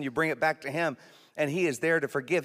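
usual speaking voice

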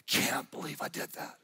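barely voiced whisper of self-reproach; hushed, private remorse; whispered regret. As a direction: whisper it to yourself in disbelief. Voicing regret on waking up.

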